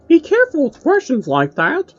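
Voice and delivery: put-on high, loud, odd puppet voice warning the kids; a mocking high puppet voice with a stern warning; Pinky voice. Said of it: "answered with things like".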